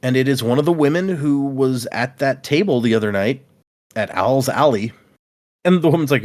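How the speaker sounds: ordinary delivery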